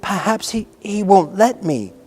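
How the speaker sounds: falter, half afraid to answer; hesitant. A narrator's reading. Nurse doubts the boy will allow it, hesitant. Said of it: "she hesitated in a low voice".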